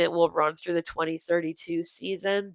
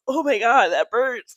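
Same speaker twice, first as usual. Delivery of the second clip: burning throat; 5 semitones higher, 6 dB louder than usual. Real, not acted. Reacting to Fireball shot.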